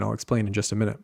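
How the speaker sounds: ordinary talk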